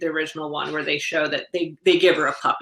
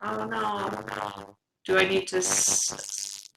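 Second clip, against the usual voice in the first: robot voice glitch; 7 dB quieter, much slower than usual. Real, not acted. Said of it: "Your voice sounds crazy".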